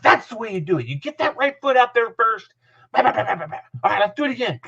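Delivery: high, urgent coaching patter; Dick Adams. Retold or imitated. Coach praising and instructing his young lineman, high-pitched urging. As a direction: rattle on encouragement in a high voice. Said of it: "He'd praise him".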